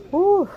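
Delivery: high, loud blown-out exhale; loud exhausted whew. Real, not acted. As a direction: puff it out like you just made it. Worn out after the snow.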